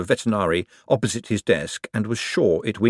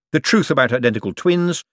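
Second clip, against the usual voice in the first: ordinary delivery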